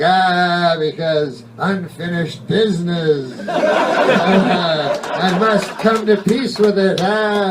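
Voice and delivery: hammed-up spook moaning; whiny ghost. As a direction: wail your excuse like a whiny ghost. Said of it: "And they're whiny".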